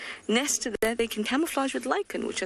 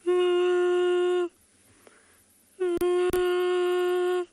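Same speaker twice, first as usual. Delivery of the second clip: high eerie moaning hum; ghost impression; 11 semitones higher than usual. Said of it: "That's my best ghost impression".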